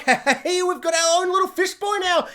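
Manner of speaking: high, gleeful mock boast; chirpy, hammed-up show-off delight; Marvel. Marvel showing off its fish boy, mock giddy pride.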